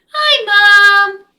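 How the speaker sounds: high, loud delighted greeting; excited greeting. Acted. Daughter rushing to hug mom, high and loud. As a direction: squeal it with joy.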